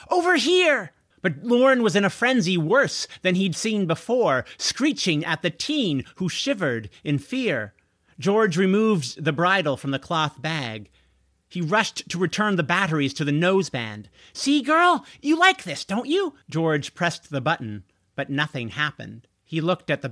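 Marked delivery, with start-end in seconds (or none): 0.1-0.9 high, loud attention-getting yell. A narrator's reading. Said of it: "George yelled". 14.3-16.4 high-pitched coaxing; coaxing a pet. A narrator's reading. Coaxing a frenzied dragon like pet.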